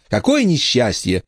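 high mock-tragic child; sarcastic little-girl voice; sarcastic lament. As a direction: jeer at a brother with fake sorrow. Emma mocks her brother.

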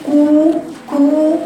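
كو high and slow with unrounded lips; lazy damma. Shown as a demonstration. Teacher mocks the lazy damma. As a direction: drag كو with slack lips. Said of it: "كأنك هتقولي كو".

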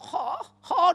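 put-on loud, high drunken hooting; drunk friend. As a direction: imitate a drunk pal calling out. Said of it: "Kawan saya nomor satu mabuk".

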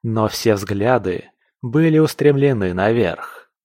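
ordinary delivery

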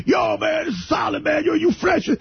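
hammed-up wannabe gangster, yelling it out; mock street slang talk. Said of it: "the one midget talks like a wigger".

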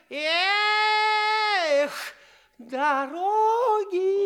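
high, slow, sung-out answer; drawn-out guess. Acted. The old king drawls out his answer, high and slow. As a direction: stretch it out.

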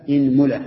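إنملة as "inmula", i first, u on the meem; closing list item, إنملة as inmula; i then u. Shown as a demonstration. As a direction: say إنملة as IN-mu-la, falling. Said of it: "اكسر الهمزة".

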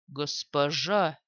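slow, low, careful call; cautious low call. Acted. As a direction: call her softly and slowly. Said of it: "Осторожный голос эхом отразился". (осторожный низкий оклик)

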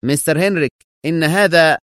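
high-pitched indignant surprise; astonished objection; shocked protest. A narrator's reading. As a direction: object in disbelief, cut off. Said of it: "في دهشة واستنكار".

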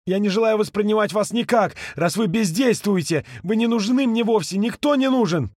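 high, angry rejection; angry outburst. A narrator's reading. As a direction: push him away with words. Ka rejects the ghost angrily. (злая отповедь)

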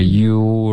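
"you" held slowly, sliding straight into an "er"; direct glide. Shown as a demonstration. Teacher showing linking, long gliding "you-r". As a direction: slide from "you" right into r. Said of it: "直接滑到儿".

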